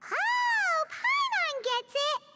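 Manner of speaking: excited. Acted. Paimon has a sudden realisation.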